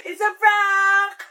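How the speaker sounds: slow, laughing announcement; laughing reveal. Acted. Child revealing the prank to her mother, slow laughing reveal. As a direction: laugh as you drag it out.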